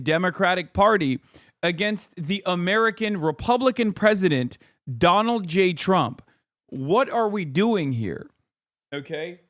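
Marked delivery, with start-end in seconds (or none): none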